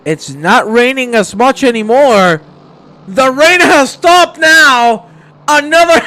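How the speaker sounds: high, very loud race call, building; excited announcement. Real, not acted. Commentator spotting that the rain has stopped, excited shouting. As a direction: shout the breaking development, pitch climbing. Sudden weather change announced live.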